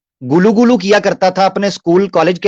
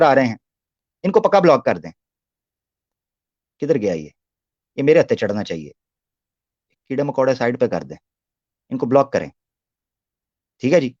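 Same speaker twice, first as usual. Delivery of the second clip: muttering aside; 7 semitones lower, 6 dB quieter, faster than usual. Real, not acted. Muttering while blocking chat trolls.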